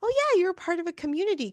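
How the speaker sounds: cheerful fan. Retold or imitated. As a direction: rattle it off brightly.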